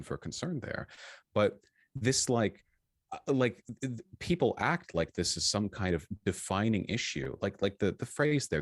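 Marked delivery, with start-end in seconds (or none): none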